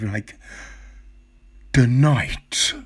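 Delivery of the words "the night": "night" hit hard, mock-dramatic narrator; imitated emphasis on night. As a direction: mimic a narrator leaning heavily on "night". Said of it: "it's just the emphasis on night".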